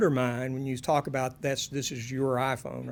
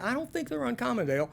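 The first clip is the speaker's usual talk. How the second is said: much higher mock objection to Dale; mock doubting listener; 8 semitones higher, faster than usual. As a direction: squeak it like a sceptical listener. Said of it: "you may think".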